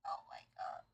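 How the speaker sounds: whispered oh my god under the breath; stunned whisper. Real, not acted. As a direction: breathe it out in shock. Stunned by dub.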